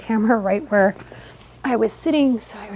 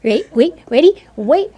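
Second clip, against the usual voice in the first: excited coaxing; 8 semitones higher, 11 dB louder than usual. Real, not acted. Photographer coaxing toddler before the count, excited.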